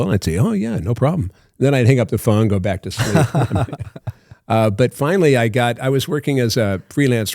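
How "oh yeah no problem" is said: higher, lilting automatic reply; imitated autopilot politeness, pitched up; auto voice. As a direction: toss off a stock assurance in a put-on auto voice. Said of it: "I developed a voice, like this auto voice".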